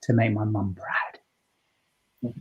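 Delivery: emotional. Retold or imitated. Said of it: "and I was emotional".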